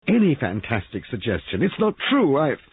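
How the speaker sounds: very high, loud and agitated; overwrought protest. Acted. Molly denying suspicions of her husband, overwrought. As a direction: burst out, unable to bear it. Molly upset, rejecting the suggestion.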